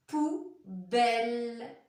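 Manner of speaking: poubelle stretched long; slow emphatic example. Shown as a demonstration. Teacher giving example to children, drawn out. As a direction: hold the word for the class.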